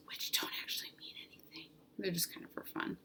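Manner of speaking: soft whispered joke, voice dropped right down; whispered aside. Real, not acted. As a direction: let it slip out as a quiet confiding aside. Joking aside about points.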